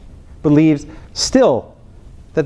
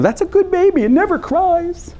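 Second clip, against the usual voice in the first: mock sweet praise. Mimicking people praising quiet baby.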